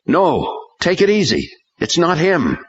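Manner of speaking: high, pressing call to hold fire; urgent shout. A narrator's reading. Hank shouts at police not to shoot Kasuf. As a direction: stop them before they shoot. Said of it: "Hank shouted".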